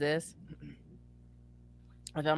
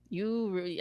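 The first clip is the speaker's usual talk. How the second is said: normal talking voice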